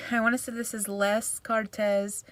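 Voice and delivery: ordinary delivery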